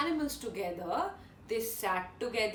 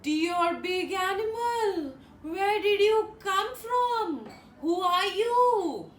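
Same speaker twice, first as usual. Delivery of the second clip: timid monkey voice; 6 semitones higher, 3 dB louder, slower than usual. Acted.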